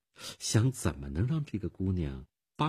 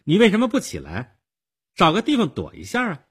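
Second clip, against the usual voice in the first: put-on high, loud, sharp girlish demand; sharp, impatient girl's question; stern demand; 12 semitones higher, 8 dB louder, faster than usual. A narrator's reading. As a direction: scold them in a high, raised voice. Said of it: "少女厉声地问道".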